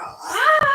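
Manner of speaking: high shouted cry; heroine's triumphant high cry; cry of release. Pretend. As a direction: let out a sudden freeing cry. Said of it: "palm it to the air and go".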